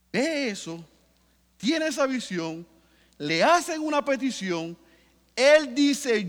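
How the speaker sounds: loud fervent preaching, voice driving upward; preaching at full force. Acted. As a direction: preach it to the back row. (predicando con fuerza y fervor)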